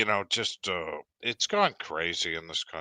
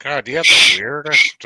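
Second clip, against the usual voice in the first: louder, exclaiming in disbelief; loud teasing; 6 semitones higher, 10 dB louder than usual. Real, not acted. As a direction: blurt it out in mock disgust. Teasing about weird farts.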